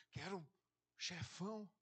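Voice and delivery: ordinary delivery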